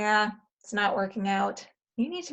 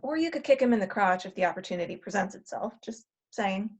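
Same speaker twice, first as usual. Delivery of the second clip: quick aside; 3 semitones lower, 4 dB quieter, faster than usual. Acted. Cheeky aside from future self.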